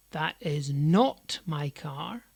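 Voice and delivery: slow, NOT punched and stretched, rising at the end; stress on NOT. Shown as a demonstration. Teacher demonstrates emphasis to learners, stress on "not". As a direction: hit the word "not" hard. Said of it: "emphasising and de-emphasising different parts".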